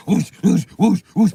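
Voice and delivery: loud rushing effect; loud whoosh imitation; whooshing noises. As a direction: sweep past with it. Acting out ramming boats.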